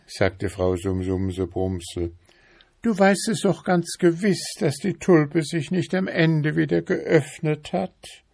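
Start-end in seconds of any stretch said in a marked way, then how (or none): 2.8-8.2 nagging wife beetle. A narrator's reading. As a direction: pester him in a high, insistent voice. Wife insists.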